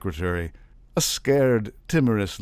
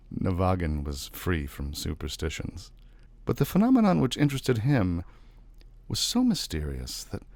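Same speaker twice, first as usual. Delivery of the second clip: ordinary delivery, nothing marked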